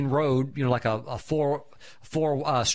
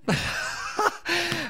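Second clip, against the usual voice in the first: a real high-pitched burst of laughter; high giddy laugh. Real, not acted. High laugh as the car drives the wrong way. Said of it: "And we started laughing".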